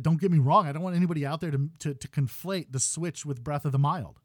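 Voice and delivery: normal talking voice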